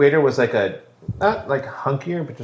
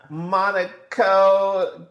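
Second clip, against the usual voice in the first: drawn out; 6 semitones higher, much slower than usual. Acted. Drawn out word.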